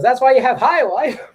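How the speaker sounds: high-pitched, loud quoted retort; mock retort. Retelling his comeback to friends, high mock retort. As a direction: throw it out as a high, mocking quote. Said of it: "and he goes".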